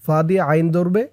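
normal talking voice

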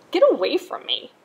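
loud brushing off; sharp rebuff. A narrator's reading. Rainbow Fish sending the little fish off, loud rebuff. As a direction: send him away. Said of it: "cried the Rainbow Fish".

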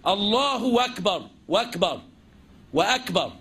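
الله أكبر with a و slipped in, وأكبر; الله وأكبر, an extra و gliding in; added waw. Shown as a demonstration. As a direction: let a little و sound between الله and أكبر. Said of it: "جت معاك ساكنة".

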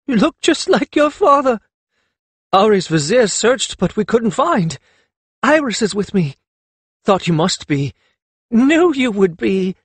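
high-pitched, excited snatches; lightly acted joyful outpouring; overcome with emotion. A narrator's reading. Mother and son both talking at once, overcome. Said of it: "laughing and crying".

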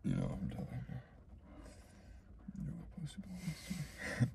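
whispering. Acted. Teasing innuendo.

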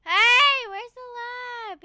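loud high cry, then drawn out; whiny high-pitched outburst; mock alarm. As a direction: call out in exaggerated dismay. Mock alarm at missing lab.

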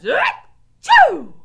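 explosive, high, very loud "achoo"; huge acted sneeze. A narrator's reading. Gregory's third sneeze, explosive and loud. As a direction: build up and burst into the sneeze. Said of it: "sneezed into it".

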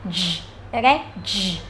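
"j" as a buzzing voiced consonant. Shown as a demonstration. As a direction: let the vocal cords vibrate on "j". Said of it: "âm này là âm hữu thanh, rung dây thanh quản". (âm "j" hữu thanh, rung dây thanh quản)

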